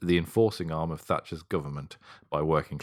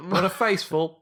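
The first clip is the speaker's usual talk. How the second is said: mock glee; 12 semitones higher, 6 dB louder than usual. Mock gleeful remark.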